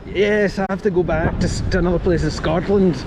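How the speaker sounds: a hint of the Billy Connolly impression; mock Billy Connolly. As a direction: keep the joke Glaswegian lilt going. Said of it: "his Billy Connolly impression".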